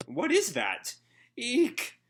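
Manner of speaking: high, squealing yelp of fright; startled cartoon squeal; frightened shriek. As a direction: shriek like you just saw a monster. Pino shrieks at tentacle.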